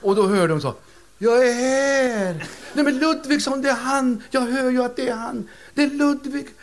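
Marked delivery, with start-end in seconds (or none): 1.2-2.5 very slow, loud, high spooky voice; hammed-up spirit voice; ghostly voice. Ghostly voice answers séance. 2.7-6.5 put-on much higher, excited old lady; mock overjoyed old woman; thrilled widow. Widow thrilled to hear husband.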